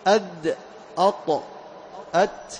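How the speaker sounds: isolated أد، أط، أت with stopped consonants; letter articulation demo. Shown as a demonstration. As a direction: sound each letter after a hamza. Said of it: "من أين تخرج؟". (نطق الحروف ساكنة بعد همزة)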